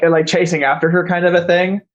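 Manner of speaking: ordinary talk, nothing marked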